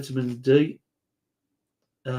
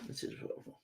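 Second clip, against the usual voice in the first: much softer, whispered repeat; whispered echo; 23 dB quieter, faster than usual. Retold or imitated. Quizmaster echoes the contestant's correction, in a whisper.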